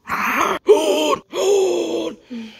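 a loud, high, sobbing gasp of fright; shrieking gasp of fear; terrified gasp. Acted. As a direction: gasp in horror at the pipe. Terrified by the metal pipe.

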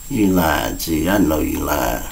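soft, slow and low; barely there, fading out; faint dying voice. Retold or imitated. Dying friend's last words.

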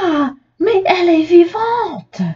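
high shocked exclamation; startled cry. A narrator's reading. La dame découvre la poupée vivante, cri surpris aigu. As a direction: gasp out that the doll lives. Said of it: "s'écrie la dame".

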